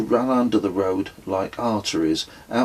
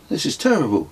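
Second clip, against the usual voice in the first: hopeless; 8 semitones higher, much faster than usual. Acted. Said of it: "I said hopelessly".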